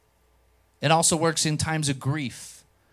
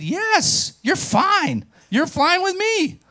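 confident reassurance; 5 dB louder, much slower than usual. Retold or imitated.

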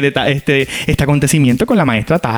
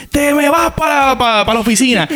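angry teacher; 10 semitones higher than usual. Retold or imitated. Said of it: "me mandó pa' la principal".

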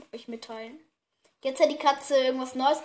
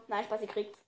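ordinary delivery

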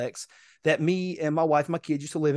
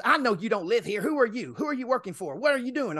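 higher-pitched mock interrogation; retold hostile questioning; 6 semitones higher than usual. Resident grills driver who doesn't live there, imitated hostility. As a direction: fire off suspicious questions as the woman.

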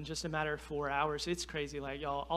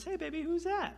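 sweet wife; 11 semitones higher than usual. Retold or imitated. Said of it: "she goes".

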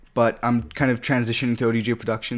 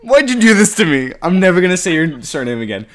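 mock exasperation; 5 semitones higher, 9 dB louder than usual. Real, not acted. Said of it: "butchered it".

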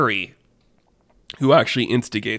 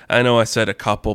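ordinary delivery, nothing marked

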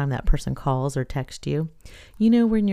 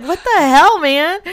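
outraged; 12 semitones higher, 7 dB louder, slower than usual. Retold or imitated. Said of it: "I was like".

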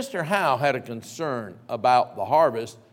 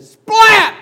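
loud high burst; boy gleefully acting out the hit; splat; 18 dB louder than usual. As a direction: shout the impact. Boy retells tomato hit.

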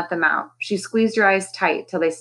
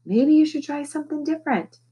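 said quietly; 9 semitones higher than usual. A narrator's reading. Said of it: "He said it quietly".

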